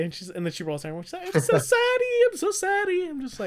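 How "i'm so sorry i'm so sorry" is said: high, exaggerated mock voice; mocking the lady who almost hit him; mock apology. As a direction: ham up a whiny sorry.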